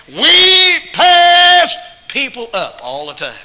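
high, loud, slow proclamation; slow, loud preaching cry; preaching shout. Real, not acted. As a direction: hammer the point home to the crowd.

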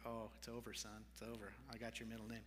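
soft, low aside as if the game is up; quietly voiced "you're done for"; resigned mock sympathy. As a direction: drop low and quiet, imitating a knowing look. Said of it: "Travis is looking at me like".

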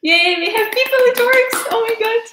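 high-pitched, delighted cheer; real excitement. Real, not acted. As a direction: cheer in thrilled relief that it works. First viewers appear in livestream chat.

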